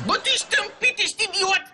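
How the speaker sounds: high-pitched exasperated insult; angry. Acted. Mayor scolding the hired victim for spoiling filming, angry. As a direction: lash out, voice shooting up.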